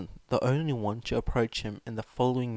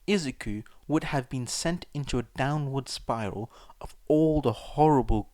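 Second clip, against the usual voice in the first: plain reading voice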